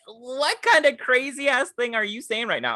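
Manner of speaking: high-pitched, disbelieving retort; incredulous outrage. Retold or imitated. Retelling her reply to a random guy, high and incredulous. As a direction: challenge him in appalled disbelief.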